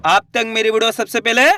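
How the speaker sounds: ordinary delivery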